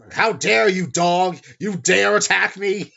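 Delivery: loud, high outraged shout; indignant, contemptuous yell; utter disdain. Acted. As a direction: thunder it in haughty outrage at a lowly attacker. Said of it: "like the utter disdain".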